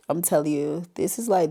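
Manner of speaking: normal talking voice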